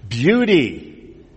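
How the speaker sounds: ordinary delivery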